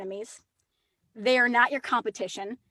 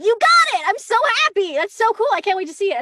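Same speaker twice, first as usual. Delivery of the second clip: much higher, louder gushing congratulations; excited; 9 semitones higher, 8 dB louder than usual. Retold or imitated. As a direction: squeal with delight for a friend. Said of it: "call them up and be like".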